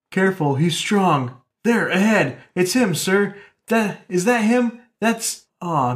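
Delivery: lively read-aloud of comic dialogue; dramatic comic reading. Acted. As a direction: read it like a radio serial.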